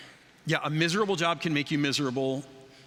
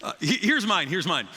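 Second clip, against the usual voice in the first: ordinary delivery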